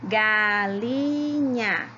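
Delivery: "Galinha" stretched into ga, li, nha; slow syllable by syllable. Shown as a demonstration. Teacher checking syllable counts with pupils, "Galinha" slowly split. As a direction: say "Galinha" very slowly, each syllable held long. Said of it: "Vamos falar todas devagarinho".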